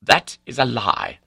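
high, loud, indignant protest; angry denial. A narrator's reading. Accused baronet denying cheating, angry. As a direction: fling back the accusation angrily.

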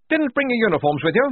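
high, loud hearty officer voice; blustering major. Acted. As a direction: boom out like a jolly old officer.

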